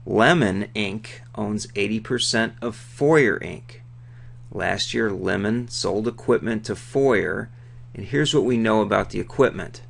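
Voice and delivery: ordinary delivery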